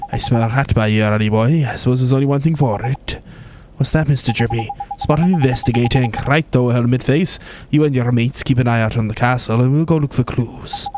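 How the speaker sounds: light Welsh lilt, cheeky and brisk; Drippy in a Welsh accent. Acted. Drippy ordering the guards, in a light Welsh accent. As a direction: play the cheeky Welsh sidekick giving orders.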